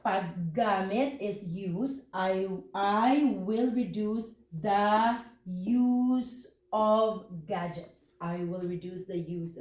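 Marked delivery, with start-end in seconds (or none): 2.1-8.1 slow translation. Shown as a demonstration. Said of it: "teacher translating slowly".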